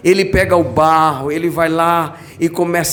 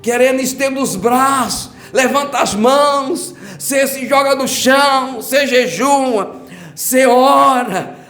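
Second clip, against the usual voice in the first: impassioned revival sermon; fervent preaching; 6 semitones higher than usual. Acted. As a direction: preach it up with rising fervour. Preaching builds to full force.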